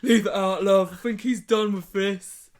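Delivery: loud laughing cockney "leave it out, love"; cockney lad telling her to drop it; cockney accent. Retold or imitated. Jack tells Abby in cockney to stop.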